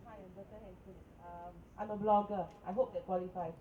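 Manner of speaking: normal talking voice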